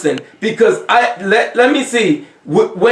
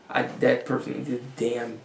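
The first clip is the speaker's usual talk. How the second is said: hushed aside; 10 semitones lower, 14 dB quieter than usual. Retold or imitated. Drops far lower and softer.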